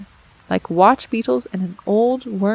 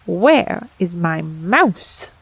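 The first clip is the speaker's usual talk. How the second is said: very slow, growled cat question; growling cat; 3 semitones lower, 3 dB louder, much slower than usual. Acted. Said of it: "spit and growled".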